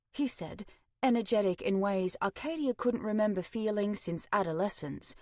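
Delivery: usual speaking voice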